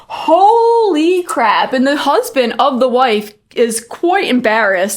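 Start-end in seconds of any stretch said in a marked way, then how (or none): 0.1-1.7 slow, drawn-out, higher "holy crap"; stunned. Retold or imitated. Watching a stranger get berated, slow stunned disbelief. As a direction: let it hang in disbelief. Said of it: "And I was just like".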